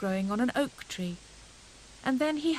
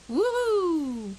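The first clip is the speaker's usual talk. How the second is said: high, loud whoop; joyful whoop; 8 semitones higher, 6 dB louder than usual. A narrator's reading. Hare racing downhill, gleeful whoop. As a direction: whoop with the thrill of speed. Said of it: "cried Hare".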